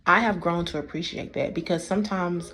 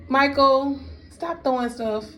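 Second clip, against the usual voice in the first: calling out to son; 7 semitones higher, slower than usual. Real, not acted. Mother calling to her son who is throwing things. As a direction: snap a name across the room, then drop it.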